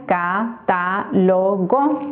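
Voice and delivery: "catálogo" slowly split into ca, ta, lo, go; Silbentrennung. Shown as a demonstration. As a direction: separate each syllable of "catálogo". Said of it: "La separación sería".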